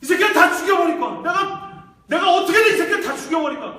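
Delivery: very high, loud vengeful shout; acted murderous fury; screaming enraged father. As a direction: bellow with uncontrollable grief and rage. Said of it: "소리 지르면서 들판에서 아들을 잃은".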